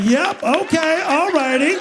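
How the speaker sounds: put-on high, loud helpless kid catchphrase; squeaky impression of the flustered worker; Mike. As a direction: chirp it with rising, clueless endings. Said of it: "I was like".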